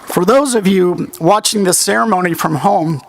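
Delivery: usual speaking voice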